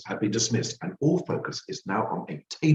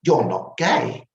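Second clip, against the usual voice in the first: lightly voiced sharp old shop owner, louder and higher; 6 semitones higher, 4 dB louder, slower than usual. A narrator's reading. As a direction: dismiss him with pointed certainty. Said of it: "she says sharply".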